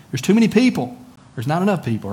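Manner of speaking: hammed-up complaint; mock complainer. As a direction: toss off the gripe in a whine. Mimicking complaining churchgoer.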